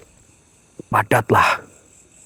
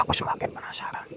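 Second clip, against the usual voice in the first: fast, breathless whisper; 9 dB quieter, much faster than usual. Real, not acted. Whispering his curiosity to the camera in the dark. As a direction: hush it so the spirits can't hear.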